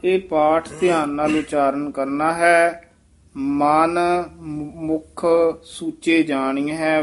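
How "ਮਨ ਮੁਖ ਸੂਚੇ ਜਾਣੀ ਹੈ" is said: ਮਨ and ਮੁਖ spoken as two words, with a pause between; slow, word-by-word recitation; ਮਨ and ਮੁਖ apart. Shown as a demonstration. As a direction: split ਮਨ from ਮੁਖ. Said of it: "ਵੱਖਰਾ ਵੱਖਰਾ ਪਾਠ ਉਚਾਰਨ ਕਰਨਾ ਹੈ".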